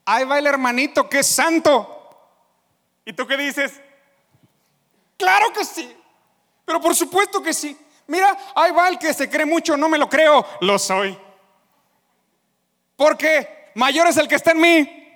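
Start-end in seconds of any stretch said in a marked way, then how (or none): 0.0-1.9 loud jeering call; mocking shout. A mocker shouting at the new believer. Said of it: "te gritan y te dicen". 5.2-15.1 loud, proud, higher reply; defiant boast. Pretend. A believer answering the mockers defiantly.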